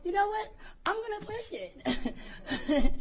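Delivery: playful boss. Retold or imitated. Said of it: "Stephanie could say".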